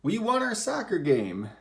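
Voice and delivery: excited exclamation. Shown as a demonstration. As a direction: cheer it like the team just won. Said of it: "saying it in a really excited or angry way".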